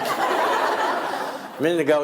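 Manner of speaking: usual speaking voice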